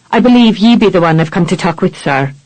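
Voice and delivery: a hint of an Irish captain, brisk; light Irish brogue, quick; Irish accent, lightly voiced. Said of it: "Irish-infected brogue".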